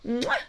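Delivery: smacking kiss sound; a quick farewell smooch; blown kiss. Real, not acted. Host signs off to viewers with a smacked kiss.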